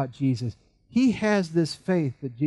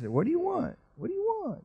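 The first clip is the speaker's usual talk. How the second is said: high, soft playful questioning; teasing Jesus; 4 dB quieter than usual. Acted. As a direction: tease him with a smile. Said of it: "he's kind of smiling".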